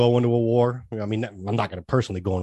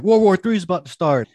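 alarmed quote; 8 semitones higher, 6 dB louder than usual. Retold or imitated. Quoting the alarmed oldest son.

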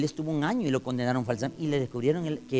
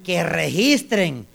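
loud, raised preaching voice; forceful preaching; 7 semitones higher, 8 dB louder than usual. Real, not acted. Preacher telling believers to let police search, forceful preaching. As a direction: drive the point home to the congregation.

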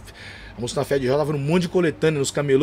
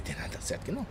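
quiet tearful protest; 8 semitones lower, 14 dB quieter, much faster than usual. Retold or imitated. Aí eu comecei a chorar.

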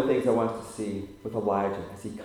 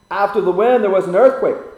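loud; 7 semitones higher, 10 dB louder than usual. Acted. Reading scripture.

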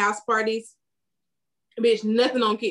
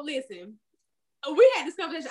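ordinary delivery

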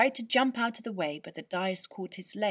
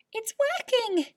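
much higher, louder and slower, thrilled; excited cry; 8 dB louder than usual. Acted. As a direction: shout it with amazed joy. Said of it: "he cried".

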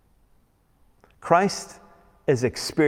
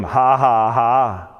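spoken, jeering ha-ha-ha; mock like a sneering crowd; sarcastic mock laugh; 4 dB louder, much slower than usual. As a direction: deliberately fake laugh, heavy with sarcasm. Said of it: "More sarcasm from the crowd".

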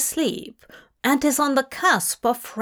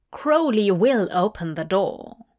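plain reading voice

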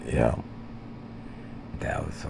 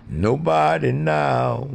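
drawn out high reply; 11 semitones higher, 4 dB louder, slower than usual. Pretend.

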